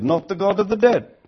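usual speaking voice

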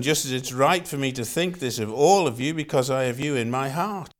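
usual speaking voice

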